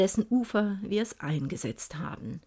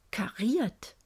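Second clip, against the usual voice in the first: hushed, stunned; a breathless whisper of wonder; 6 dB quieter than usual. Acted. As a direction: whisper kariert in disbelief. Stunned realisation.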